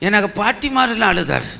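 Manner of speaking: ordinary delivery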